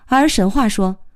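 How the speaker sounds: plain reading voice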